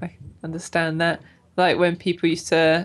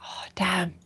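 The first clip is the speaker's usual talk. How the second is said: whispered; 4 dB quieter than usual. Real, not acted. Disappointed aside.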